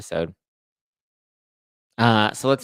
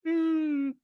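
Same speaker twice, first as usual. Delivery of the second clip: musing hum. Retold or imitated. Imitated mom's musing hum.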